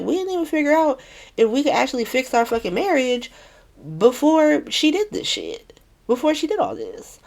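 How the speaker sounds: high-pitched, hurried mock emotional plea; retold upset, pitched well up; imitating teary upset. Son venting to his mom about his marriage, mock emotional. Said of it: "like, teary-eyed and emotional".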